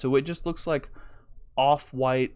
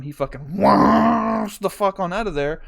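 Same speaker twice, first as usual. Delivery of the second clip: whooshing burst; 5 semitones higher, 5 dB louder than usual. Retold or imitated. Car speeding off, sound effect.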